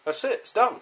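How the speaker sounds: high, loud and pleased; excited. Real, not acted. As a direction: announce it proudly. Proud reveal of finished part.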